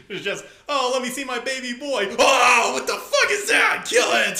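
shrieking high in horror, then shouting; horrified shriek. Retold or imitated. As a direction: scream at the sight of the baby. Parent horrified at newborn baby.

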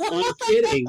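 high and loud, thrilled; excited exclamation. Retold or imitated. Neighbor admits he is a stand-in, high and loud. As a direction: squeal it like big news. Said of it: "He's like".

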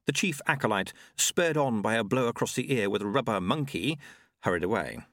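plain reading voice

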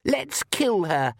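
put-on high, slow, gleeful girl; eager vampire girl. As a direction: relish it like a bloodthirsty child. Daughter's voice.

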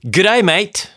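Australian accent. Shown as a demonstration. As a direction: sell it with a put-on Australian accent. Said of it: "without doing the accent".

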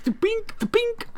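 very high, quick cartoon "pink, pink"; high-pitched "pink" sound effect. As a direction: imitate a cartoon teleport blip. Imitates the teleporting shake sound.

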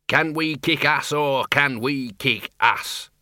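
slow, drawn-out gleeful boast; happy burbling. A narrator's reading. Dean celebrates with fellow wizards, happily pumped up. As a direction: relish each word like an action hero. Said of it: "burbled the Dean happily".

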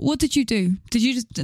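usual speaking voice

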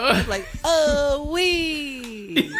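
high, squeaky Pee Wee "Oh, wee"; Pee Wee moan. Retold or imitated. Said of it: "Pee Wee Herman porn parody".

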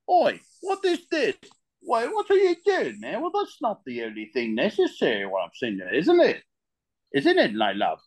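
hammed-up British geezer, starting very high; cheeky British accent, pitch swooping down from a high squawk. Shown as a demonstration. Guest performing for hosts, exaggerated British accent. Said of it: "do you wanna hear the British accent now".

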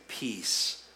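slow hushed blessing; gentle Jesus voice. Acted. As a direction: breathe peace onto frightened friends. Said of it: "and he said".

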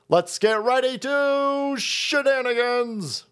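slow drawn-out announcer call; ring announcer. Acted. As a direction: boom it out like a boxing announcer.

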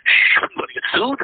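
high, hushed whisper. Retold or imitated. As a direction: whisper it like a secret, fearing a lawsuit. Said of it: "And he goes".

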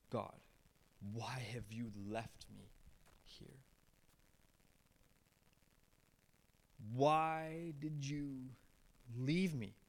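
a hushed cry of abandonment; anguished cry. Retold or imitated. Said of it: "He's crying out to his own Father".

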